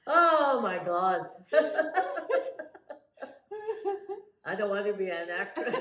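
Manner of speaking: high giggly laughter; scream into giggles. Real, not acted. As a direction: giggle helplessly through the words.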